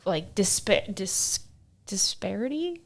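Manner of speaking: whispered word after stumbling. Real, not acted. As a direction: breathe out the word, unsure.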